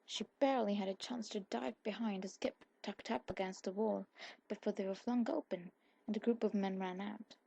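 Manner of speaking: usual speaking voice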